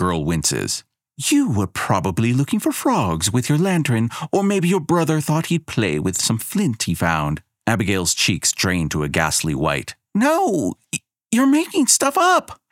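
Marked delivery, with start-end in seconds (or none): 1.2-7.4 higher, sly goddess spinning a lie. A narrator's reading. Goddess frames the girl before the village, sly and sweet. Elena voices the accusation. 10.1-12.6 put-on much higher, stammering little girl; a scared child denying it; frightened girl. A narrator's reading. Girl denies the goddess's accusation, high and scared.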